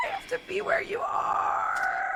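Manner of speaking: high, slow, breathy wail of a pining lover; mock anguished lover. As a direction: ham up a heartbroken plea, half crying. Mock dramatic reading of Vronsky.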